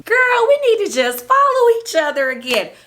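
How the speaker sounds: high, loud mock plea; imitating castmate. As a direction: play her gushing, sing it out high. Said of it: "she said to me".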